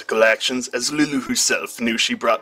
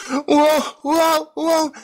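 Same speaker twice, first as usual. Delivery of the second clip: dizzy whoas; much slower than usual. A narrator's reading. Lulu gets dizzy, high climbing whoas fading into woozy. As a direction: sound giddy and spun around. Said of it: "Lulu said".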